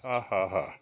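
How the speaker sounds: deliberate, spoken ha-ha-ha laugh; mock laugh lesson; demonstration laugh. Shown as a demonstration. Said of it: "Teach you how to laugh".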